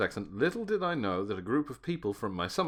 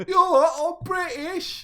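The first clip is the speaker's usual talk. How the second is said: very high, hammed-up British squawk; mock British accent; 7 dB louder than usual. Host imitating a bad British accent, high and exaggerated. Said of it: "in a British accent".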